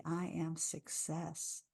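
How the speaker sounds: slow, soft, felt in the body; felt affirmation. Shown as a demonstration. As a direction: say it as if feeling it inside. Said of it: "I feel it in my body".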